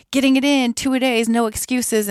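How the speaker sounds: pumped-up mock workout motto; imitated fitness girls. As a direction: mimic girls hyping their workouts. Voicing online fitness girls' posts.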